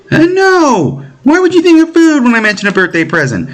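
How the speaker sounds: put-on high exasperated girl's voice; high-pitched sister correcting her dense brother; indignant little sister. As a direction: scold him like a fussy kid sister. Voices Mishka scolding.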